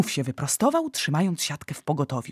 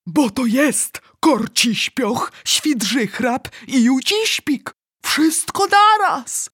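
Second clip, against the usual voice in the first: high, loud, slow wail; despairing cry; 7 semitones higher, 6 dB louder, slower than usual. A narrator's reading. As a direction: cry out each name in rising despair. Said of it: "zawołał Wielkomilut z rozpaczą w głosie".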